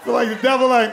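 ordinary talk

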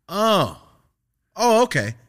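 high, loud imitation of dawning realisation; changed tone. Officer learns the student made the call, changed tone. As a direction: back off with an exaggerated "oh". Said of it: "Changed his whole tone".

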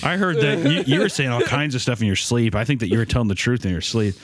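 ordinary delivery

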